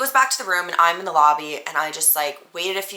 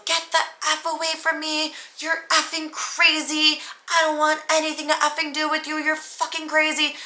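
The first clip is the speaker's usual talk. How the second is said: much higher, retold scream; frightened yelling to push him away; scared screaming; 9 semitones higher than usual. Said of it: "start screaming 'cause I'm scared".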